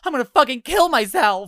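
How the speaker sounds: high, loud mock meltdown; over-the-top yelled threat; hysterical shout. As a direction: imitate a drunk passenger's dramatic outburst. Rider threatens in drunken rage.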